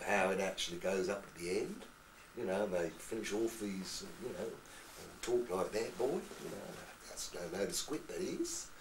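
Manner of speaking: Norfolk lilt rising at the end; Norfolk accent. Shown as a demonstration. Actor showing the music of Norfolk, Norfolk accent. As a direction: talk in a Norfolk accent, swinging up on phrase endings. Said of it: "you start to hear the music of Norfolk".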